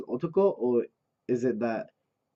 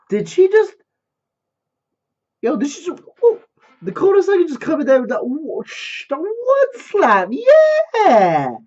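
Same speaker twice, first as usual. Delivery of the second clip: loud, high-pitched hype outburst; losing it with excitement; shocked excitement; 11 dB louder, slower than usual. Real, not acted. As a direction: yell in disbelief, building to a drawn-out cheer. Stunned reaction to the slap.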